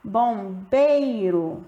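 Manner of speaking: Bombeiro stretched and sounded out; slow demo word. Shown as a demonstration. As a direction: say Bombeiro slowly so they hear it. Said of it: "Percebam na hora de pronunciar". (Bombeiro pronunciado bem devagar)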